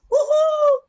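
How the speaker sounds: high, loud gleeful whoop; rodeo-style whoop; whooping cheer. Retold or imitated. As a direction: let out a big high holler. Cheering while riding a quad bike.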